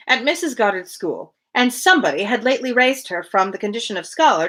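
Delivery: even, measured reading-aloud voice; steady literary narration; story narration. Acted. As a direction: read the classic novel aloud to a friend. Resumes reading novel aloud.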